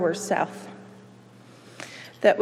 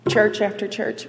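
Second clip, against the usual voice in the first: ordinary talk, nothing marked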